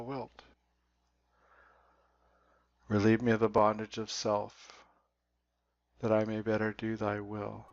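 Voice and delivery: measured recital with long, reflective pauses; slow prayer reading. Real, not acted. Reading the third step prayer for meditators to sink into, slowly. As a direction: read the prayer line by line, pausing after each phrase. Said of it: "I'm just gonna read it through slowly".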